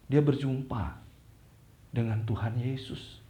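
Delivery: normal talking voice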